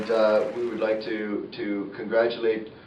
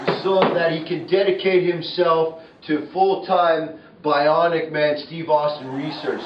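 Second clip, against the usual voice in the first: announcer voice; 6 semitones higher, 4 dB louder than usual. Acted. Announcing the number one reason.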